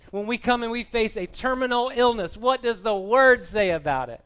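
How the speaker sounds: loud, high-pitched preaching at full force; excited preaching. Real, not acted. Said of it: "I'm sorry, I'm getting excited".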